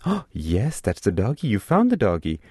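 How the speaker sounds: a lightly voiced parent, bright with praise for the baby; engaged parent voice. Parent praising baby who taps a picture, mildly engaged voice. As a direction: cheer the baby's find, mildly played. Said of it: "the parent says".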